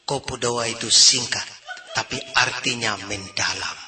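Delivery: slow, low, measured verdict; deadpan earnest praise; mock solemn. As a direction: pronounce it gravely, with pauses. Friend solemnly appraises silly prayer.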